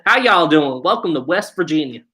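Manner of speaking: exaggerated Southern welcome in a West Virginia twang; put-on West Virginia accent. Shown as a demonstration. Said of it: "That was very, very put on".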